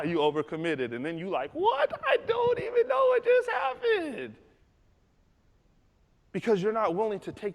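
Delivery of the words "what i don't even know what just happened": very high, slow squeal; hammed-up high-pitched panic; bewildered. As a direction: wail it in exaggerated confusion. Acts out someone baffled after overcommitting.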